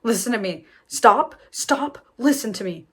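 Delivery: mock commanding doctor demanding focus; firm doctor. Doctor to hyperventilating mother, firm and urgent. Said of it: "the doctor is like".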